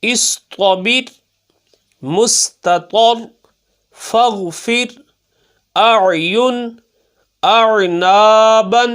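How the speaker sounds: each word drawn out slowly, final letters bounced; slow qaida recitation; slow recitation with qalqala. Shown as a demonstration. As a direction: recite syllable by syllable like a teacher. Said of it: "خوب ظاہر کرنا ہے قلقلہ".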